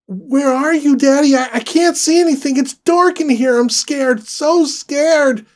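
put-on high, loud frightened child; scared little girl. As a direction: cry out like a lost child. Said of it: "Her voice cracked".

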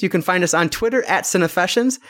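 normal talking voice

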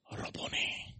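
breathy whispered name; whispered devotion. Acted. Blind man's intimate address.

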